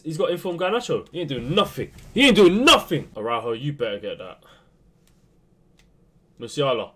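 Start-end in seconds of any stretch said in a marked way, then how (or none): 2.1-3.1 loud repeat. Real, not acted. Repeated complaint, second louder.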